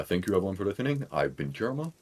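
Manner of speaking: ordinary delivery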